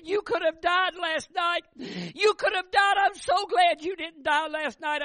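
high, loud and slow, near tears; emotional outburst. Retold or imitated. Wife tells her husband he could have died, near tears. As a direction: tell him you nearly lost him.

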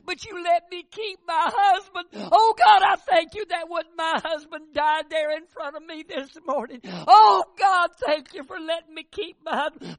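high, loud, weeping plea to God; tearful prayer. Retold or imitated. Wife prays aloud to God about her lost baby, weeping. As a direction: pour out grief and thanks through tears.

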